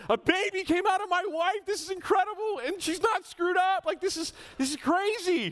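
high, loud, amazed; overjoyed new dad. Retold or imitated. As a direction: squeal it out like a proud new dad. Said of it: "I was like".

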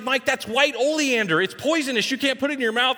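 high-pitched, urgent imitated scolding; upset teacher. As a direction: imitate a frantic teacher warning a student. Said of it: "She's all upset".

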